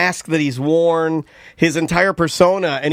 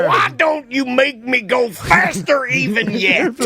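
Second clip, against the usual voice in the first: imitating old man; 6 semitones higher than usual. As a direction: mimic his outburst, pitched way up and stretched. Mimicking old man from clip.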